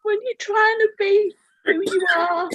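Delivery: fighting back tears; tearful. Real, not acted. Lonely light worker asking the group why, tearfully. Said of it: "that's why I'm sat here crying".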